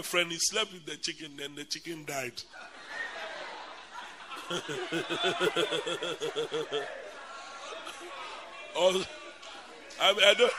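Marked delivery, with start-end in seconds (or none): 2.9-8.8 long breathy laugh. Real, not acted. Telling the congregation about the chicken, a long breathy laugh. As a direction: laughs helplessly at the story.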